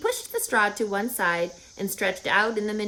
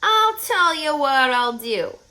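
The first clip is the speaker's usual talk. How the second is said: rat's voice; 6 semitones higher, 6 dB louder than usual. Acted. Templeton the rat speaks.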